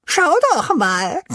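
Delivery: hohe, laute Pinsel-Stimme voller Freude; begeisterter Ruf. A narrator's reading. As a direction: ruf es begeistert nach oben. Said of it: "ruft Pinsel begeistert". (begeistert rufend)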